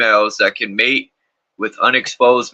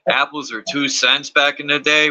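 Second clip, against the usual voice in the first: ordinary talk, nothing marked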